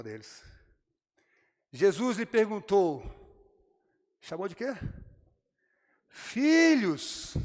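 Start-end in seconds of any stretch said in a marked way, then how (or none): 6.1-7.3 a loud, high call across the water; shouting from the beach. Acted. Jesus calls the disciples from the beach, loud high shout. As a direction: call out to them far offshore. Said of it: "Gritando lá da praia".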